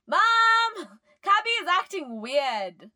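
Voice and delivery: high, loud brother's tattling shout; yelling for Mom. A narrator's reading. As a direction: holler to your mother in another room. Brother yells to Mom for help.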